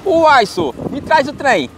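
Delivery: put-on Minas Gerais accent. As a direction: play a cheerful mineiro asking for the thing. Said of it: "uma frase mineira assim".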